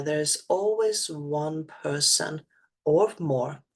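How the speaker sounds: normal talking voice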